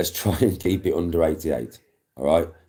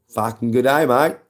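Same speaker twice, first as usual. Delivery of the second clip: mock Australian; 8 semitones higher, 6 dB louder than usual. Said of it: "You're Australian".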